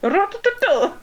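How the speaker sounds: high yelled war cry Ra-da-da-da; battle cry. Pretend. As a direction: scream it like charging into battle. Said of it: "my battle cry is".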